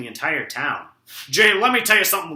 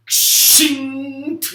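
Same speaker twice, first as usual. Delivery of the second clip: sword shing; 9 semitones higher, 5 dB louder than usual. Acted. Mimics a sword being drawn.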